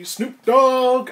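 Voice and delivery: mock rap intro shout; hype shout. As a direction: belt the name like an intro.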